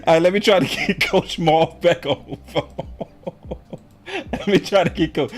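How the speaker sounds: chuckling while talking; laughing through words. Real, not acted. Host still laughing as he dials the coach. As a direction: talk through the giggles.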